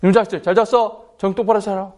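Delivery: imitated rough morning telling-off; gruff with boys. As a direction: order the boys about roughly. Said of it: "남학생들한테".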